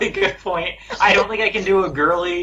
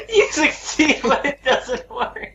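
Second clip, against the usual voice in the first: laughing mock repeat; 10 semitones higher, slower than usual. Mocking the GM's ruling.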